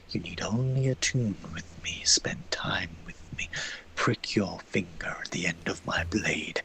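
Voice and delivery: slow, low unvoiced sinister whisper; eerie whisper. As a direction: tempt them in a cursed whisper. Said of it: "this eerie voice in the back of your head".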